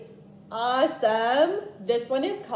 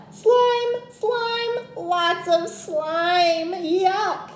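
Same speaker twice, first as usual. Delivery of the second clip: yelled; 7 semitones higher, much slower than usual. A narrator's reading. Little brother yells in disgust at snail slime. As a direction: squeal it out, grossed out. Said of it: "yelled Tate".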